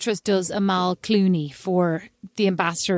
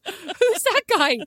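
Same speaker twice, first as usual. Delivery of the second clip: high mock puzzlement; 12 semitones higher, slower than usual. Mocking her own reaction to actor.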